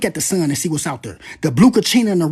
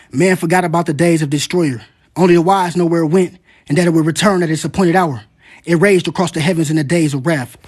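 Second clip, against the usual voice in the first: grave, measured reading voice; solemn reading to the listeners; prophecy reading; 2 semitones lower, 4 dB louder than usual. Acted. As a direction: read it like ancient scripture. Reads from the prophecy.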